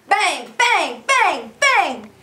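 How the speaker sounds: loud, bouncy bang bang for the dog; excited trick cue. Real, not acted. Guest gives Grizzly the bang bang cue, loud and excited. Cueing the play-dead trick.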